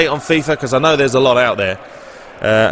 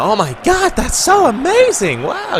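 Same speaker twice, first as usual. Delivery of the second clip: very high mock-amazed girly voice; imitated adoring girls; 14 semitones higher, slower than usual. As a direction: squeal like starstruck girls, hammed-up. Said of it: "they're all loving it".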